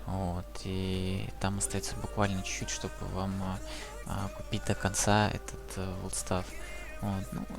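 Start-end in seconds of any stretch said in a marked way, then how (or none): none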